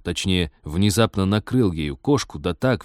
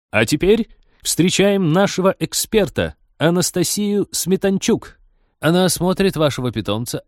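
show presenter; 6 semitones higher than usual. A narrator's reading. Presenter introducing the expert to exhibitors, announcing. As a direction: project it grandly, welcoming an expert. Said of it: "на подиум вышла ведущая".

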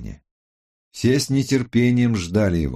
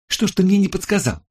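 high, quick comeback; indignant retort; 11 semitones higher, 4 dB louder, faster than usual. A narrator's reading. Tech expert fires back at accusing colleague, indignant. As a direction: throw the blame right back, pitched high.

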